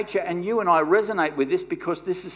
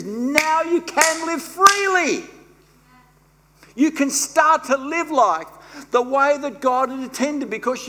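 much higher, louder ringing climax; full-force preaching; 7 semitones higher, 5 dB louder than usual. Acted. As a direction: lift the room with it.

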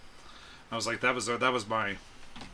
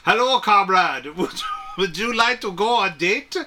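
put-on Russian accent; 8 semitones higher, 6 dB louder than usual. Mock Russian bot.

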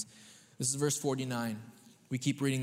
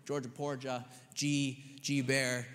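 ordinary talk

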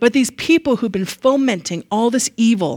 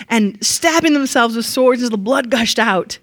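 usual speaking voice

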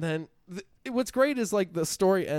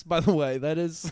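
ordinary delivery

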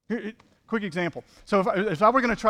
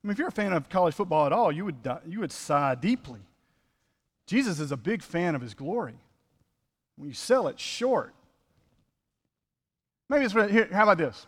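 usual speaking voice

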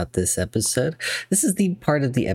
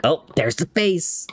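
higher-pitched, drawn-out exclamation of recognition; mock recognition; 8 semitones higher than usual. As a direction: point it out slowly, pitched up. Acting out sudden recognition of meme.

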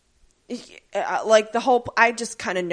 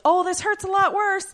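high, louder cry of hurt; pained exclamation; 11 semitones higher, 5 dB louder than usual. Retold or imitated. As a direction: squeal it like it stings. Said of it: "I was like".

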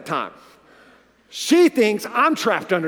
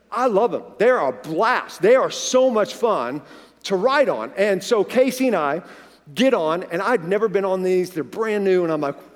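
ordinary talk, nothing marked

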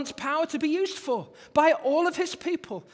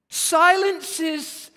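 ordinary delivery

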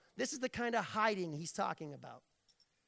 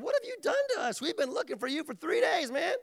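high-pitched comic scolding; hammed-up exasperated parent, voice jumping high; 7 semitones higher, 4 dB louder, faster than usual. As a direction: scold a runaway kid in mock distress. Parents scolding runaway young Jesus.

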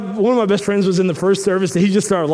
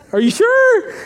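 mock disbelief; 4 dB louder, slower than usual. Pleading with club lady.

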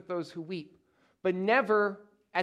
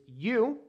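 "you" lifted higher and set apart; stress on YOU; 5 semitones higher than usual. Shown as a demonstration. Preacher shows the Greek emphasis, "you" stressed. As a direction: lean on the word "you". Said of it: "with emphasis placed on the you".

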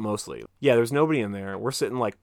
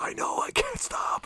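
whispered admission, higher; 4 dB quieter than usual. Retold or imitated. Friend answers the teasing in a whisper. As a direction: whisper it back, sheepish.

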